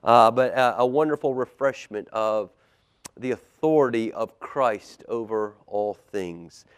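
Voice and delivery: plain reading voice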